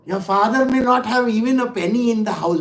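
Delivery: usual speaking voice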